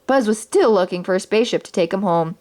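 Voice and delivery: plain reading voice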